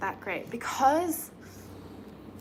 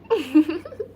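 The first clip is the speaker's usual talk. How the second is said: laughing; 6 semitones higher, 8 dB louder than usual. Real, not acted. Laughs at her own hair.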